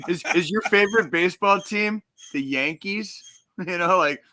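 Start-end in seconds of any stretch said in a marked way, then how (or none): 0.1-3.2 high, slow teasing question; mocking high voice. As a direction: tease him with a high, slow voice.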